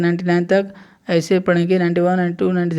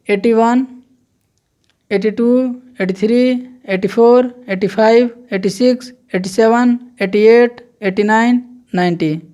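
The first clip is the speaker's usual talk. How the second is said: counting drill; 4 semitones higher than usual. Shown as a demonstration. Teacher counting eighties aloud for students, emphatic drill. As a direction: recite each number clearly for the class. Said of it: "देखिए".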